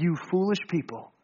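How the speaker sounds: low, soft rebuke; stern. Acted. Jesus turns to the travelers, quietly reproving. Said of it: "lest we think Jesus is mad at them".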